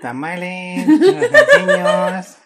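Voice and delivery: high, loud nasal tamale call; nasal vendor recording voice. Retold or imitated. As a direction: blast it like a nasal vendor speaker. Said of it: "Con su voz nasal".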